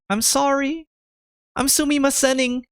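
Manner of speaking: high-pitched imitation of a tearful girl; mock bawling apology. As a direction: whine the apology as if upset. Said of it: "she's bawling, and she's saying".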